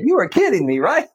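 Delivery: high grandma impression, questioning; sweet grandma. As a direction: pitch it up like a sweet old granny. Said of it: "She just sounded like a grandma".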